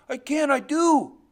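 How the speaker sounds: put-on high, loud child's retort; defiant insistence. Boy insists to his father he remembers, defiant cry. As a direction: throw it back at him at full voice.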